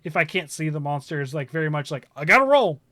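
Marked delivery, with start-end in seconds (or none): 2.2-2.8 raised, louder playful alarm easing off; mock urgency. Player reacting to the lookout call, playfully urgent. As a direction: act out a sudden dodge warning.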